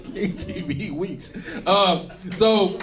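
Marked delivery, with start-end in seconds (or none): 0.1-1.3 laughing. Real, not acted. Repeating a quote while laughing.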